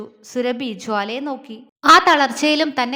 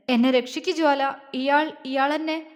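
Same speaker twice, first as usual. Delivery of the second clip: mild crying plea. A narrator's reading. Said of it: "Surabhi കരഞ്ഞു".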